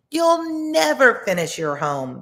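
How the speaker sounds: high, slow, mocking taunt; teasing. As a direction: sneer it out like a bully. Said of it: "the second little pig said".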